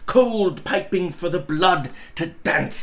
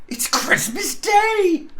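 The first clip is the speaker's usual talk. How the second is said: much higher, slow, overjoyed; delighted realisation; 14 semitones higher than usual. Acted. Scrooge to himself, high and delighted. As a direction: let it dawn with delight. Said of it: "said Scrooge to himself".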